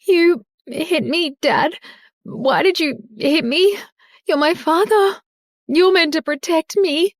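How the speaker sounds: high, slow, wounded daughter; pleading with the father who just hit her; heartbroken plea. A narrator's reading. Daughter to the father who slapped her, high and heartbroken. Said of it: "she muttered, heartbroken".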